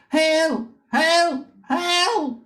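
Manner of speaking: high cries for help, rising each time; distant cries for help. Acted. Survivors crying out from the water for help. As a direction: call out from far off for rescue. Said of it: "hear the shouts of".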